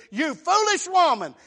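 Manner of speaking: loud, high taunt; preacher's acted jeer; mocking outburst. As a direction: call it out scornfully at full voice. Preacher acts out mocking atheist neighbor.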